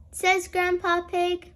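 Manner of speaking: plain reading voice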